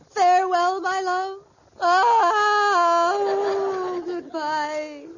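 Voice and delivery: hammed-up dying goodbye; milk the deathbed farewell; melodramatic farewell. Wife bidding husband a melodramatic deathbed goodbye.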